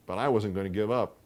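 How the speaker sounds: usual speaking voice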